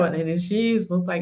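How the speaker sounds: ordinary talk, nothing marked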